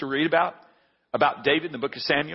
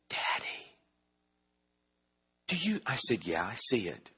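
softer, lower hushed exchange; 6 semitones lower, 9 dB quieter than usual. Retold or imitated. Father and son hushed over an ugly bumper sticker. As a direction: keep it down in the car.

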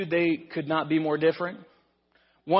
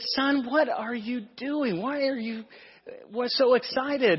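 puzzled parent; 6 semitones higher than usual. Retold or imitated. Parents puzzled at the manure-covered son.

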